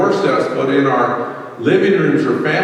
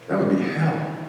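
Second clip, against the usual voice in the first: hushed, softer echo of 'hell'; softly repeated 'That would be hell'; quiet repeat; 7 dB quieter than usual. Shown as a demonstration. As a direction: drop your voice and let it land. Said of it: "Because that would be hell".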